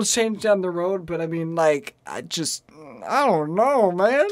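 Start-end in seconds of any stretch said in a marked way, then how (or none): 3.1-4.3 slight Southern drawl on "I don't know, man"; southern accent. Real, not acted. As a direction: let a Southern accent slip in. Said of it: "my southern accent popped up there".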